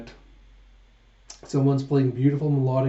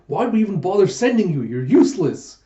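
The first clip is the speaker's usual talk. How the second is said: louder, higher exasperated telling-off; scolding; 5 semitones higher, 6 dB louder than usual. Retold or imitated. Yun scolding Huck for buying nothing, loud and exasperated. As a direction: scold him for coming back empty-handed.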